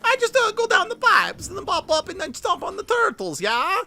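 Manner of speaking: put-on very high, loud Italian plumber accent; Mario voice. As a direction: bounce it like a cartoon Italian plumber. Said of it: "the janitor's like".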